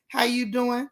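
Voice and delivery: ordinary talk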